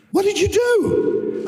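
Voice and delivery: imitating the agent.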